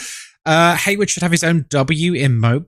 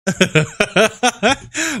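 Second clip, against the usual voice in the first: long run of chuckles; amused laugh; 4 semitones higher than usual. Real, not acted. Streamer teased by chat about head size, laughing. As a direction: chuckle helplessly in quick bursts.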